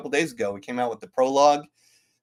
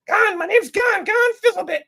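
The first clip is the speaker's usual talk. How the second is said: very high, squeaky little character voice; Gon the goblin voice; 6 dB louder than usual. Retold or imitated. [name] replaying his game character to viewers, very high squeaky voice. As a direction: squeak it out, a cheeky little goblin introducing himself. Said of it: "I voiced all four of them".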